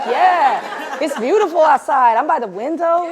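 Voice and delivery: hyped. Retold or imitated. Friend writing at coffee shop, hyped. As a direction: imitate a friend delighted with his spot. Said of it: "You're hyped to be writing".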